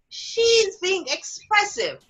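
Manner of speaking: high, slow, precious parent voice; mocking indulgent parent. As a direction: mimic a doting parent, high and stretched out.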